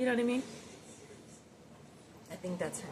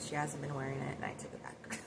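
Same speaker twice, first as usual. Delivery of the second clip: ordinary talk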